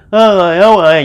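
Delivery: high, loud call heard from afar, words blurred; muffled far-off call. Retold or imitated. Neighbours hear only a distant blurred call, high and loud. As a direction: call so the words smear into vowels. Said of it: "bà con thì chỉ nghe là".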